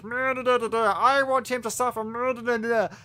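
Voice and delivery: high-pitched, nonsense-filled mimicry; mock him with high, yammering singsong; mock villain babble. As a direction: caricature of a whiny background villain. Mimics Agent Orange babbling in the background.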